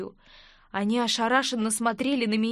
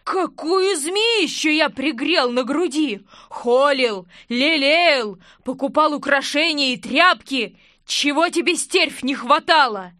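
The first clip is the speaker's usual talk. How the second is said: loud, drawn-out lament; wailing husband berating his wife; slow, heavily stressed outburst; betrayed fury; 3 semitones higher, 5 dB louder, slower than usual. A narrator's reading.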